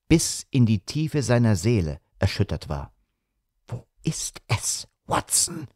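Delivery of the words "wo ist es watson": shaken whisper rising high at the name; fearful hushed question. A narrator's reading. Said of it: "flüsterte Holmes". (zitterndes Flüstern, der Name hoch)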